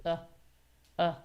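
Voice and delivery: quiet, soft "uh" with still lips; no effort on "uh"; little schwa. Shown as a demonstration. Teacher showing a relaxed schwa. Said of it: "Watch my lips".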